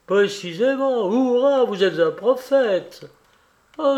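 put-on a burst of boyish triumph; exultant. Kolia cheering Alexei as a prophet, exultant.

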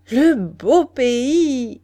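high, loud, drawn-out cry of delight; ringing, elongated exclamation. A narrator's reading. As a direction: exclaim it slowly, savoring each word. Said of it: "s'écria-t-elle".

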